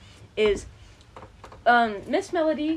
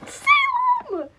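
exasperated shout; slower than usual. Real, not acted. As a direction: call the name in disbelief. Calling out friend's name.

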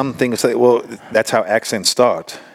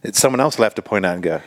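ordinary delivery

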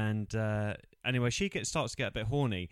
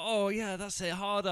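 high, loud hammed-up moaning; mock moaning woman; 10 semitones higher, 3 dB louder than usual. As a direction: ham up her pleading, higher and louder. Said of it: "She's like".

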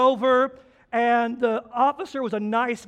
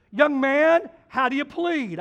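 judge asking plea; 5 semitones higher, 5 dB louder than usual. Retold or imitated. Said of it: "the judge says to me these words".

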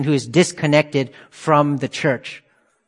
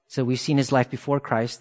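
ordinary talk, nothing marked

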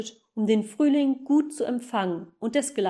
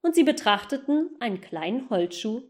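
ordinary delivery, nothing marked

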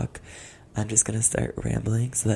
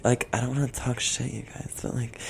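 normal talking voice

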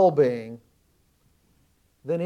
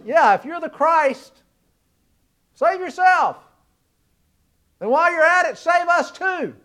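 mocking sneer; 9 semitones higher, 5 dB louder than usual. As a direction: jeer at him like the crowd, pitched way up. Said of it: "this first criminal turns to him and says".

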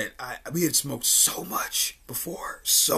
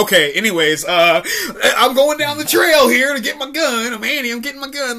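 high, loud hammed-up stage line; imitating a high school actress, hammed up; mock stage actress; 11 semitones higher, 9 dB louder than usual. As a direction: rush through the line like a play. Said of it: "she would just be like".

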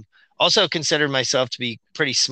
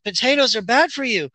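put-on high, naive kid voice; child's voice; 11 semitones higher than usual. Child telling mom what TV says, higher voice. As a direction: repeat it like a little kid.

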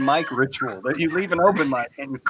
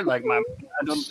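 ordinary talk, nothing marked

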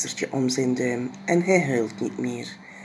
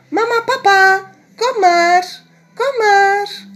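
put-on much higher, louder children's call; 11 dB louder than usual. Jip and Janneke calling mama and papa downstairs. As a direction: call the parents down proudly.

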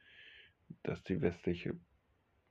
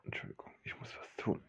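hushed apologetic whisper; 13 dB quieter, faster than usual. Acted. Whispering to the guard to slip away. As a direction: whisper it so no one hears. Asking again quietly.

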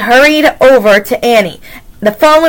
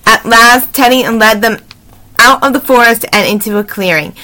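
usual speaking voice

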